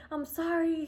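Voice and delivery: high, sugary put-on voice of the officer; sweet-talking officer. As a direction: play the officer turning suddenly sweet and apologetic. Officer apologizing.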